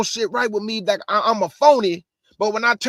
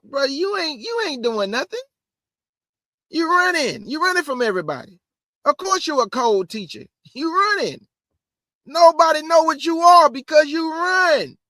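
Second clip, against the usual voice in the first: heated call-out; 6 semitones higher, 4 dB louder than usual. Real, not acted. Calling out teachers who run.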